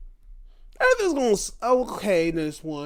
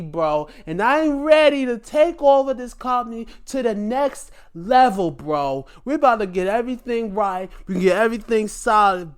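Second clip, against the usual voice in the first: higher, louder, brash booth-boss voice; loud boss calling for Roddy; Vince Russo impression; 8 semitones higher, 5 dB louder than usual. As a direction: play a cocky boss giving orders. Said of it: "once he started talking like this".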